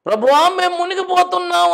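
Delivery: high loud panicked cry for rescue; frantic cry. Retold or imitated. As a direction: shout as if drowning. Disciples crying out in storm. (మునిగిపోతున్న శిష్యుల కేక)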